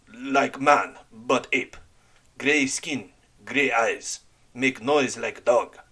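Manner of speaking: put-on slow, broken English in a heavy foreign accent; halting foreign accent, searching for words; frightened man in a heavy accent. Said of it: "in his heavy accent".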